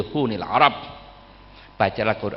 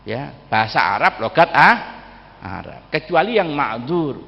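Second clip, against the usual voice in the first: ordinary talk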